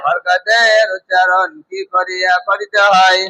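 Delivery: slow, measured classroom recitation; rule recitation. Shown as a demonstration. Teacher leading a class through a reading rule, slow recitation. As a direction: chant the rule out slowly, word by word.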